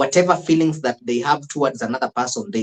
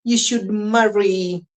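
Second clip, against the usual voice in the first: higher, slow, admiring advice; a lightly voiced elder praising Cleo; impressed elder, imitated; 9 semitones higher, slower than usual.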